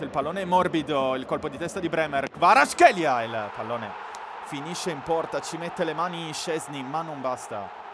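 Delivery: ordinary talk, nothing marked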